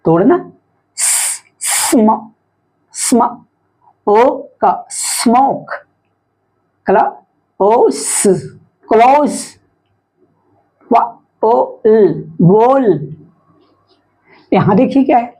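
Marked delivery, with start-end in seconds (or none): 1.0-5.7 long hissed स, then स्म, ओ, का, स्मोक; sounding out. Shown as a demonstration. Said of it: "इसको पढ़ के देखते हैं". 6.9-9.4 क्ल, ओ, स apart, then क्लोज; sounding out. Shown as a demonstration. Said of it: "इसको पढ़ के देखते हैं". 10.9-13.1 व, ओ, ल apart, then वॉल; sounding out. Shown as a demonstration. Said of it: "इसको पढ़ के देखते हैं".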